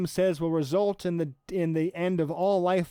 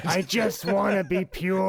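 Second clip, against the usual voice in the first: imitating Danny DeVito. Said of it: "Danny DeVito ... saying".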